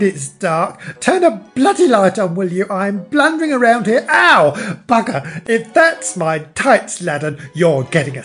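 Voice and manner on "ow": loud, high acted "ow"; sudden loud cry of pain; pained yelp. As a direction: yelp as if bumping into furniture. Mother blundering in dark.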